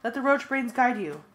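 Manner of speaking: loud high urgent commands; shouted orders. A narrator's reading. Narrator shouts escape orders to friends, loud and high. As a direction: bark orders to fleeing friends.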